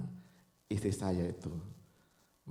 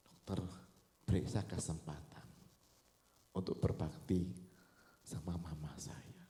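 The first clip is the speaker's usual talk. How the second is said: low, softening entreaty; hushed plea; 5 semitones lower, 4 dB quieter than usual. Retold or imitated. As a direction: plead quietly, fading almost to a whisper. Emotional plea to doctor repeated.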